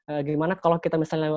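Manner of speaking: ordinary delivery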